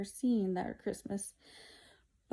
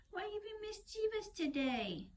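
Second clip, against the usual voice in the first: much higher, softer cooing voice; 12 semitones higher, 6 dB quieter than usual. Real, not acted. Mom cooing at her mischievous cat, high soft baby talk. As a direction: coo at the naughty cat.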